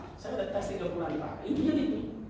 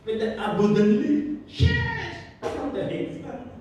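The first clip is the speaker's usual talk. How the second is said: preaching at full force; 4 semitones higher, 4 dB louder, slower than usual. Real, not acted.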